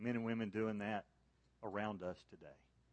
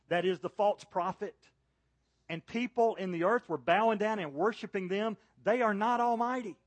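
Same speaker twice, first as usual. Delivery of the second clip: preaching at full force; 8 semitones higher, 7 dB louder than usual. Real, not acted. Preacher booming to the congregation about God's might. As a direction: proclaim it like a revival preacher rising to a peak.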